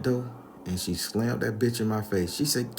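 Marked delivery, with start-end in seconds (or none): none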